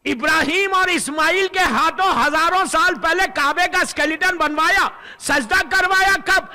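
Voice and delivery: building to a loud shout on آئی; full-force preaching. Real, not acted. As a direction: rouse the crowd. (پرجوش خطابت)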